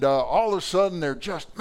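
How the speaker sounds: ordinary delivery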